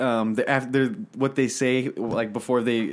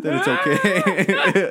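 laughing; 7 semitones higher, slower than usual. Real, not acted. Laughing through the punchline.

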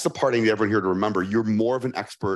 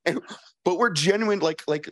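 usual speaking voice

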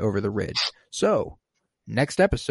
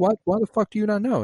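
much higher, fast disbelieving quote; incredulous squeal; 12 semitones higher, faster than usual. Retold or imitated. Agnes stunned Vision doesn't know, high fast disbelief. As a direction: blurt it out in shocked disbelief. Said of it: "And she's like".

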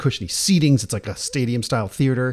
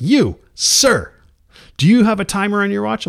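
higher, louder stage voice singling someone out; magician calling out; 9 semitones higher, 5 dB louder than usual. Retold or imitated. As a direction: imitate a showman addressing the crowd. Magician calls out an audience member.

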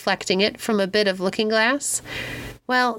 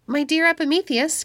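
higher-pitched, eager address; mild excited cry; 8 semitones higher than usual. A narrator's reading. Pandora cries to Epimetheus about the voice, mildly excited. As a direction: call to him with a touch of excitement. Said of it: "cried Pandora".

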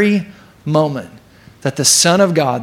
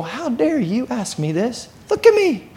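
high-pitched, hammed-up offended angel; indignant angel; 9 semitones higher than usual. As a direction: squeak with mock outrage at being questioned. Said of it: "the angel doesn't go".

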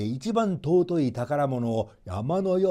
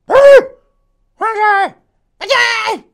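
animal cheer; 16 dB louder than usual. Acted. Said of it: "と叫びました". (高く引き伸ばした大声の万歳)

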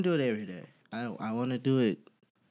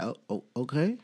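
muted, pointed acknowledgement; passive-aggressive; 3 semitones lower, 5 dB quieter than usual. Acted. Partner tired of doing it, quietly passive-aggressive. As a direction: softly feign going along with it. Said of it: "I'm passive aggressive as hell".

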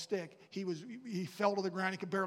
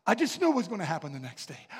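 ordinary talk, nothing marked

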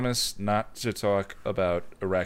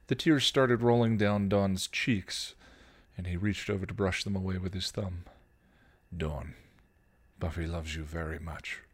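story narration; 3 semitones lower, 4 dB quieter than usual. A narrator's reading. Reads fan fiction aloud.

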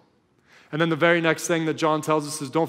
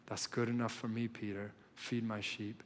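much softer and lower, tender; reassuring; 4 semitones lower, 14 dB quieter than usual. Acted. Jesus reassures hurting Peter with soft, tender comfort. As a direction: comfort him gently, letting it fall soft.

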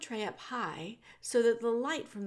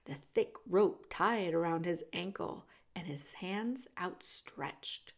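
ordinary delivery

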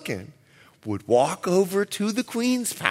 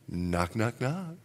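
very soft, low knock-knock; 8 semitones lower, 11 dB quieter than usual. Retold or imitated. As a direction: say it like gentle taps at night. Acting the knock softly.

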